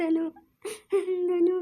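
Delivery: sobbing out her husband's name; crying. Acted. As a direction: call his name between sobs. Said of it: "ಅಳ್ಬೇಡ, ಸುಮ್ನಿರಮ್ಮ".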